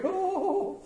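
high mock-fretful oh; teasing the worried congregation; mock worry. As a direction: ham up the panic. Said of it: "everybody was worried".

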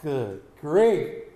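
slow, drawn-out exasperated groan; mock dread at a ringing phone; lightly played weary sigh of a tired pastor. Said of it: "I look at that thing and go".

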